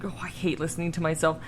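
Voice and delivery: ordinary delivery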